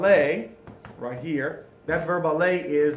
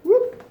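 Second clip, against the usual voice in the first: short, high, loud whoop; bright little exclamatory whoop; playful whoop; 8 dB louder than usual. Real, not acted. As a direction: let out a quick high "whoop". Playful exclamation while turning on lights.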